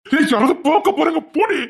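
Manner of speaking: high, loud warning; urgent shout. Acted. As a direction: warn her sharply in panic. Husband fears being seen. (உயர்ந்த குரலில் பதற்றமான எச்சரிக்கை)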